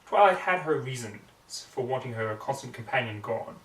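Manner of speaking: plain reading voice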